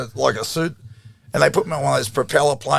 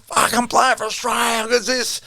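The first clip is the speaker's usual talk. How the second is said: excited; 9 semitones higher than usual. Retold or imitated. Quoting own thrill at debut.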